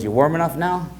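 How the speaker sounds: lightly voiced uncle checking on the boy; teasing uncle. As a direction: toss it off like a tease. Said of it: "he goes".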